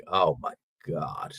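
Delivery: low, drawn-out dismay; a slow, pained groan of disbelief; cringing reaction. Retold or imitated. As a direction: drag it out, cringing. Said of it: "some of it is just like".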